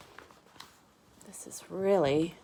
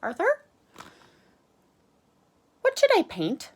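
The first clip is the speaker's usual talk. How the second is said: put-on very high timid kid voice; Max asking; 5 dB louder than usual. Max taps Arthur asking what to paint, very high small voice. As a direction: ask it in a tiny eager voice. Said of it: "he taps Arthur on the back".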